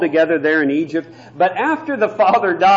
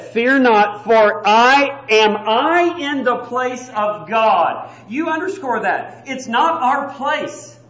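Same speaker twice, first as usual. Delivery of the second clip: loud, slow, emphatic pulpit delivery; preaching at full force; 6 semitones higher, 5 dB louder, slower than usual. Acted. Preacher to congregation, loud and emphatic. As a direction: hammer each phrase home from the pulpit.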